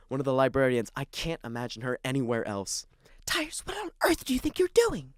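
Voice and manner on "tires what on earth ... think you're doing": stern library whisper, higher; hushed scolding librarian. Acted. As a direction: scold without raising your voice.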